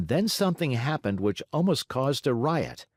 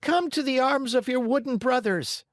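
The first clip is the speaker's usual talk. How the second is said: loving invitation. Acted. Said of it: "At such a loving invitation".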